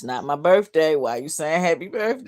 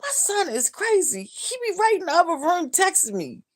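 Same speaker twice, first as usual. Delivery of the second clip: amused exasperation. Real, not acted. Amused about her son.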